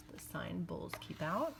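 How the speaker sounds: hushed whisper repeating the sign. Shown as a demonstration. Teacher whispering the sign to the listeners. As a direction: breathe the words like a secret.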